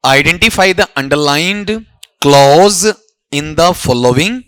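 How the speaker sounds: each word laid out slowly for the class; dictating the question slowly to students; slow dictation. Shown as a demonstration. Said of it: "सवाल क्या बोलो".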